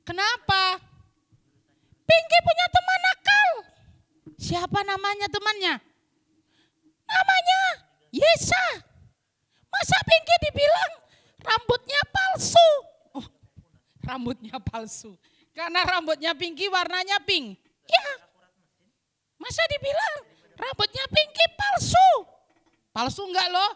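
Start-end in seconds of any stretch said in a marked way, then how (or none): 2.1-3.5 high, loud pouting puppet; sulky puppet girl. Acted. Puppet Pinky complains. 7.1-12.7 high, loud pouting puppet; sulky puppet girl. Acted. Puppet Pinky complains. 17.9-22.1 high, loud pouting puppet; sulky puppet girl. Acted. Puppet Pinky complains.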